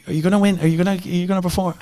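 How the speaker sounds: mock Galway mum, very high; high-pitched Galway mother impression; Galway accent. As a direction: quiz your son in a high Galway accent. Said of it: "There's my best Galway accent".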